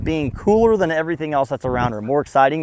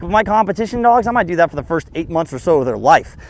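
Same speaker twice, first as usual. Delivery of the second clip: ordinary delivery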